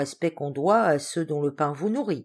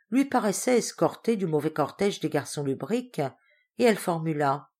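ordinary delivery

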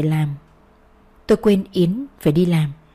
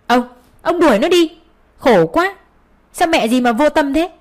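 angry order; 8 semitones higher than usual. A narrator's reading. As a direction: drive her away in fury. Mother-in-law orders her out.

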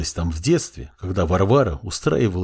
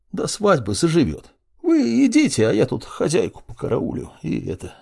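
put-on folksy old servant woman; 6 semitones higher than usual. Servant reassures the prince.

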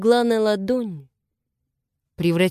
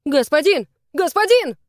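high, urgent pleading calls; anxious clamour, rising in pitch; 7 semitones higher, 4 dB louder than usual. A narrator's reading. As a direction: call out over each other to the master. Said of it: "загомонили лисы".

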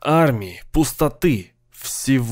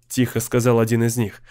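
usual speaking voice

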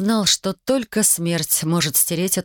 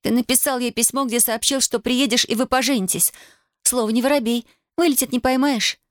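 accusing; 6 semitones higher than usual. A narrator's reading. Heated accusation continues.

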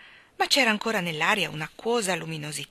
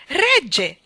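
high, loud happy cry; delighted shout; 7 semitones higher, 9 dB louder than usual. A narrator's reading. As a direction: cry out in glad discovery. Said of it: "gridò tutto contento".

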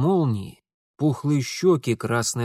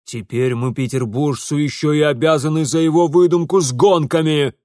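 loud indignant father's complaint; exasperated ranting; 3 semitones higher, 8 dB louder than usual. Acted. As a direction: rant about the humiliation. Father laments the family's disgrace.

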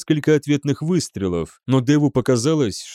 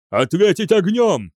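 higher, forceful command; urgent order; 7 semitones higher than usual. A narrator's reading. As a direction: order the crew in the heat of battle. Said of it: "приказал Ван Аунгер". (громко отдать боевой приказ)

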